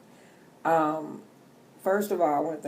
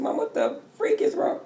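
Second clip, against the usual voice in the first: put-on much higher, alarmed child's voice; daughter's alarmed question. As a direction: squeak it like a worried daughter. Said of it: "But I said".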